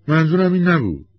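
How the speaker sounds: high, loud, sharp protest; heated outburst. Acted. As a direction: burst out defensively. Wife's heated reply in quarrel.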